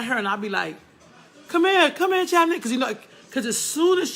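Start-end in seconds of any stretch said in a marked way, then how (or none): 1.5-2.6 loud high call across the room; mock summons with a laugh. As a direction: shout her over, half laughing. Calling niece over to confront.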